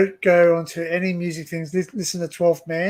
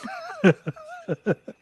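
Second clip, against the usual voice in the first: a high, squealing burst of laughter; high giggling laugh. Real, not acted. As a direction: crack up at his own joke. Laughing at his own story.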